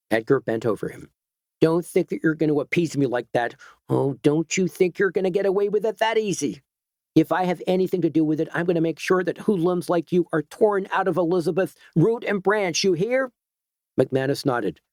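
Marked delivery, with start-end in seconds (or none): 1.6-13.3 heated, pushing higher toward the end; angry threat. Acted. Edgar bent over McManus, threatening him, building heated anger.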